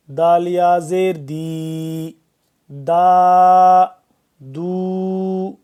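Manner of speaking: clear, deliberate spelling out of دال زبر دا; teacher modeling each sound for students; syllable drill. Shown as a demonstration. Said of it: "teacher drills letter syllables".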